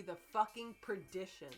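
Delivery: normal talking voice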